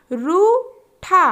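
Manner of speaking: रूठा stretched very slowly; slow drawn-out word. Shown as a demonstration. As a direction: draw out रूठा syllable by syllable. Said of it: "तीन वर्ण वाले शब्द".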